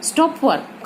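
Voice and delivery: Stop work joined as one falling phrase; no pause. Shown as a demonstration. Teacher explaining the first sentence's meaning, stop work unbroken. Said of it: "पहले sentence का meaning है".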